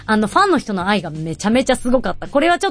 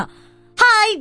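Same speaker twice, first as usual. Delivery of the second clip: cheery greeting; 11 semitones higher, much slower than usual. Retold or imitated. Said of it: "みたいな".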